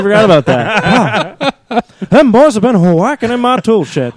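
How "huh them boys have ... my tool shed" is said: high, indignant cartoon old man; cartoon character impression. Neighbour complaining about boys in tool shed, high indignant voice. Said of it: "Dueling Hank Hills".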